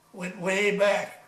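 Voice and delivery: usual speaking voice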